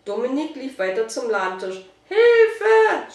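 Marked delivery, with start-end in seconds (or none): 2.1-3.1 high, loud cry for help; screaming. A narrator's reading. Shop woman keeps calling for help, screaming. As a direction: shriek it out in alarm. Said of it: "schrie die Verkäuferin weiter".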